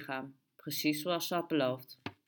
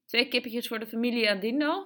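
higher-pitched, lilting vendor's voice; Peruvian shopkeeper; 6 semitones higher, 4 dB louder than usual. A narrator's reading. The Peruvian takes a regular family's order, high lilting voice. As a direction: take the order in a raised, chirpy voice. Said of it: "zei de Peruaan".

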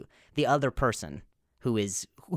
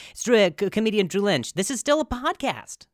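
excited; 7 semitones higher, 5 dB louder than usual. Real, not acted. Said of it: "switched back to my exterior".

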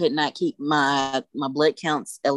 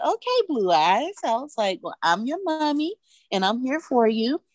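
put-on tender to baby; 8 semitones higher than usual. Mother speaks tenderly to newborn.

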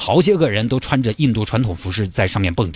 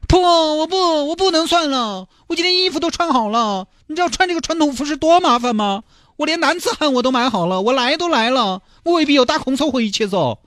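high whiny groom. Acted. Groom refuses to go home.

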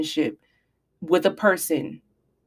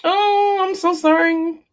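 mock apology; 9 semitones higher, much slower than usual. Mock apology after a harsh point.

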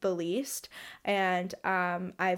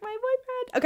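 high-pitched gushing squeak; acted girlish swoon, pitched way up; giddy gushing. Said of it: "I'm just like".

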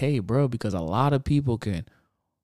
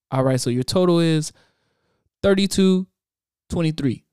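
raised, routine cashier voice; tired bored cashier; 5 semitones higher, 3 dB louder than usual. Retold or imitated. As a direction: read the total like a bored cashier. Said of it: "cashier talks".

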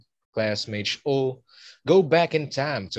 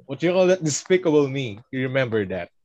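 ordinary delivery

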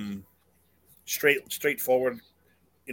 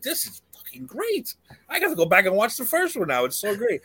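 thrilled, high-pitched rave; excited; 8 semitones higher than usual. Retold or imitated. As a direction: gush like a new convert. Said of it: "I was like".

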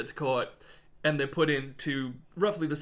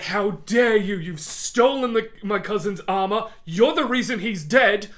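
loud, higher, furious noble yelling; furious accusation; 6 semitones higher, 9 dB louder than usual. Retold or imitated. Ramsay screams at Archibald over the armor, furious. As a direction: hurl the accusation at him. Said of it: "Ramsay's like".

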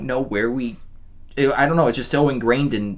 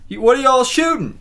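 higher, louder call from a window; nosy passer-by; 13 semitones higher, 5 dB louder than usual. Retold or imitated. Stranger shouting from his window to a film crew, nosy call. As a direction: imitate a stranger calling out to a crew. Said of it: "pops his head out from".

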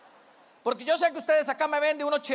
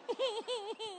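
high, soft pretend sobbing; mock child crying; 8 semitones higher, 11 dB quieter than usual. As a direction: sob quietly like a scolded little boy. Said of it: "deja de andar llorando por tontadas".

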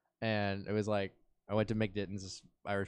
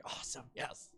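retold hushed celebration; whispered relief; 13 dB quieter than usual. Driver let off with a warning, whispered retold relief. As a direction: whisper a private little victory.

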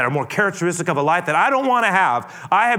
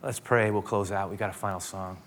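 ordinary talk, nothing marked

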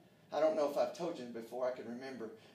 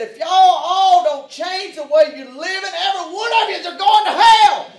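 angry shout; 27 dB louder than usual. Retold or imitated. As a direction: blast the judgment at the whole room. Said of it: "I said it with anger in my heart".

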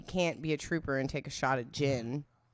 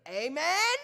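high, rising amen, inviting others to join; hammed-up congregation amen; leading a response; 9 semitones higher than usual. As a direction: lift it high like a playful call-back. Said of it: "Say it with me".

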